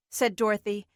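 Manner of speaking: ordinary delivery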